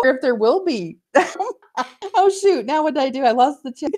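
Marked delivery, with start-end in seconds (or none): 2.1-3.9 flustered surprise. Real, not acted. Flustered after losing the chat.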